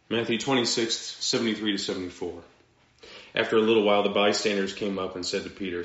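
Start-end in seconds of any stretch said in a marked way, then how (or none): none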